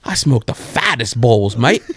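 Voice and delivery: slow, boastful Australian impression; mock Australian brag; Australian accent. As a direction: imitate an Australian mate, stretched out. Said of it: "he's Australian me".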